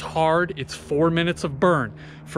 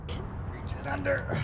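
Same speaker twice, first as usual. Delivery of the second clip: strained effort; 10 semitones higher, 10 dB quieter, slower than usual. Real, not acted. Straining mid ab exercise.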